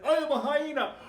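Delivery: high, loud hammed-up battle cry; proud yell. Jared declares himself a hyena to his banana, proud yell. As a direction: yell it with full bravado.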